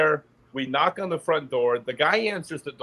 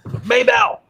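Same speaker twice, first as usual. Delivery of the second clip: shouted name, much higher and louder; calling out; 7 dB louder than usual. Retold or imitated. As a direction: yell for her across the yard. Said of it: "he goes".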